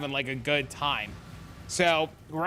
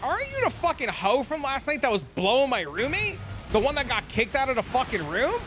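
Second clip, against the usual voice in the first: accusing callout; 7 semitones higher than usual. Retold or imitated. Calling out the girl publicly.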